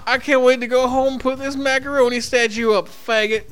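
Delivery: hippie Sage. Pretend. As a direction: ham up a blissed-out hippie named Sage. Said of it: "My name is fucking Sage".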